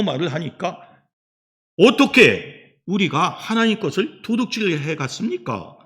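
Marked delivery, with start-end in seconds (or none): 1.8-5.8 higher-pitched mock objection; incredulous protest. Israelites answering God's charge of theft, incredulous protest. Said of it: "요렇게 또 항변을 합니다".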